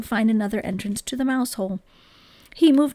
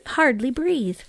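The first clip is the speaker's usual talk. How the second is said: ordinary delivery, nothing marked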